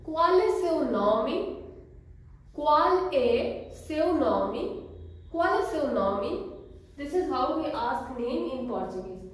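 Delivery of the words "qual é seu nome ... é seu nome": Qual é seu nome repeated slowly as kwal e seu nomi, rising at the end; slow model phrase. Shown as a demonstration. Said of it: "So repeat it with me".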